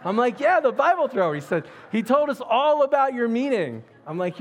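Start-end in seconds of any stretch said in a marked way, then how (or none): 0.4-1.3 very high, loud exclamation; excited echo. Retold or imitated. His excited echo of the caller. 1.9-3.8 very high, loud retold excitement; excited reply. Caller's excited retold answer.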